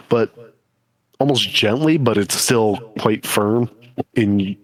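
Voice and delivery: normal talking voice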